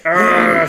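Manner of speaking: loud imitated angry growl; mad dog growl. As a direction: growl like a dog raring to chase. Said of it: "She was hella mad".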